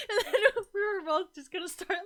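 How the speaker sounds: high, loud, breaking into laughter; laughter pushing the voice up; laughing while telling. Real, not acted. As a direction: tell it through a real laugh. Laughing through a funny crying story.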